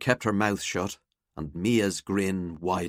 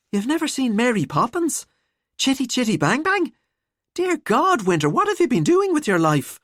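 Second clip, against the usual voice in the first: high-pitched, appalled schoolfriend; theatrical disbelief, pitched up; incredulous friend; 14 semitones higher, 3 dB louder than usual. Acted. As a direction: scold a friend in mock horror.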